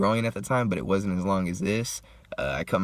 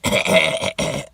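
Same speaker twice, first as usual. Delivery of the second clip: loud imitated hacking cough; mock coughing fit; 3 semitones lower, 8 dB louder than usual. Man coughs out the dab, loud acted hacking. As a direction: act out choking on a hit. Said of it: "So I start coughing out the dab".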